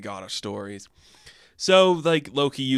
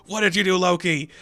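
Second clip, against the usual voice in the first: louder and higher, quickening toward the end; pleading reproach; 5 semitones higher, 5 dB louder, faster than usual. Acted. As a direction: scold a runaway friend, half upset. Fandral confronting Loki.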